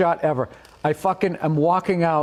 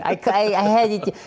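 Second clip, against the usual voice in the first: high-pitched whine; whiny high voice defending himself; high whiny Bobby impression; 9 semitones higher than usual. Bobby quoted justifying himself.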